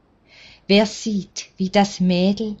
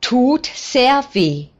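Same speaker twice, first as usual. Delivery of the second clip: slow, pained, a touch of a hiss, higher and louder; mild hiss; 7 semitones higher, 5 dB louder than usual. A narrator's reading. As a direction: let it slip out slowly through the pain, a bit sharp. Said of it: "faucht er ein bisschen".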